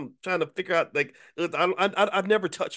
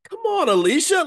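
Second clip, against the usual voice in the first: high pleading whine; 7 dB louder than usual. Retold or imitated. Pleading with wife in story.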